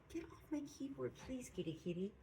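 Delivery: high, soft kitty-directed plea; talking to a cat. Real, not acted. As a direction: coax the kitty off the desk. Shooing a cat off the keyboard.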